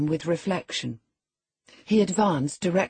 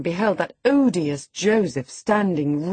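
ordinary delivery

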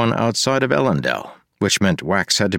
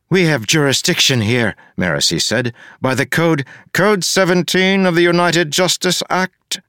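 higher, louder, insistent captain; firm officer; 12 semitones higher, 4 dB louder than usual. Acted. Marasi answering the mayor, firm and higher. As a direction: state the law like a lawbook. Narrator voices Maracy.

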